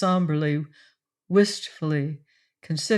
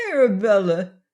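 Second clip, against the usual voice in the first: pleading cry; 3 semitones higher, 5 dB louder than usual. Acted. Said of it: "he cried on a note of pleading".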